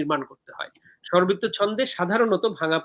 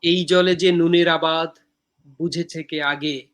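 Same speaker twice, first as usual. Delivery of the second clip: poem recitation. Acted. Poet reciting own poem from Dehokabyo to viewers, measured recitation.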